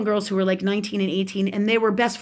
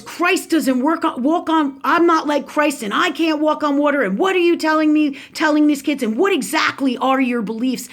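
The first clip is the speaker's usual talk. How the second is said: higher-pitched, indignant mock tirade; imitating a nasty aunt; 4 semitones higher, 3 dB louder than usual. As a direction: confront them like an outraged relative, retold. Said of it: "really nasty and unwell with it".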